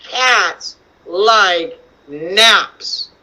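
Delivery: very slow, with gaps between words; word-by-word reading. Shown as a demonstration. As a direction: read slowly, one word at a time. Reading aloud for children to follow.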